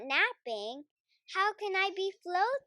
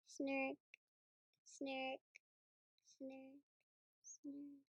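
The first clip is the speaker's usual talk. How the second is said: soft snurks; 2 semitones lower, 18 dB quieter, slower than usual. Acted. Snoring fades into sleep.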